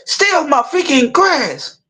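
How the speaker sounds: high, slow indignant complaint; mock outrage. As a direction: stretch out the angry grievance. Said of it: "he said".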